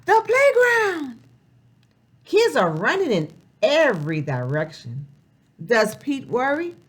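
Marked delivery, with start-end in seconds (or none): 0.1-1.2 much higher and louder, thrilled announcement; excited reveal. Acted. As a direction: unveil the playground with delight. Revealing the page.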